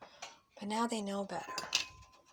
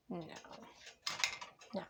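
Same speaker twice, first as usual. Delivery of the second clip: ordinary talk, nothing marked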